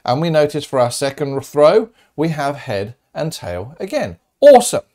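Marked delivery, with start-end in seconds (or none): 4.4-4.8 a loud, high, happy "Awesome"; excited. Real, not acted. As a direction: burst out high and loud, delighted. Teacher cheering a result.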